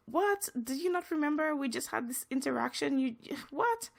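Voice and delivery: high-pitched, stunned questioning; retold disbelief, starting high on the first word; shocked disbelief. Stunned manager to abusive customer after their friendly exchange, shocked disbelief. Retells her shock at being abused.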